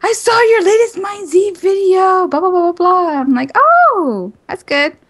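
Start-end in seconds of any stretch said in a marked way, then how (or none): none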